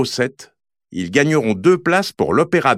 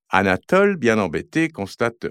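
ordinary delivery, nothing marked

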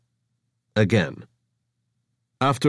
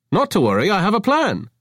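chirpy reassurance; 12 semitones higher, 4 dB louder than usual. Acted. Avra's high chirpy voice.

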